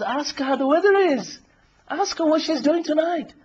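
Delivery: high, insistent prompting; Shaitan. Acted. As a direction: egg him on with a high-pitched nudge. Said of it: "and then the shaitan says".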